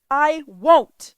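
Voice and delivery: loud, high angry snap; furious boy snapping at the computer; snapped. A narrator's reading. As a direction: snap it back defiantly. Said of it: "Guy snapped".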